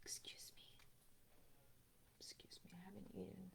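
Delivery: breathy whisper. Real, not acted. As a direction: whisper it under your breath. Whispered burp apology.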